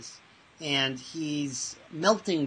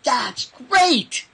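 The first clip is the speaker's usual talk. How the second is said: sudden bright high shout of delight; loud and high, selling the good news; excited exclaim; 10 semitones higher, 9 dB louder than usual. Retold or imitated. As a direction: burst out with mock enthusiasm. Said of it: "I look at him in the eye and I go".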